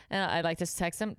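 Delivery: ordinary delivery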